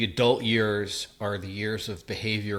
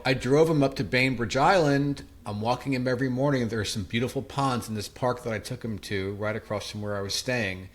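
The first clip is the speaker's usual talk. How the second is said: ordinary talk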